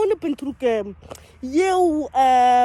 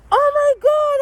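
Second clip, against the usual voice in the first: mock shock; 10 semitones higher, 6 dB louder than usual. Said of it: "I'm so shocked".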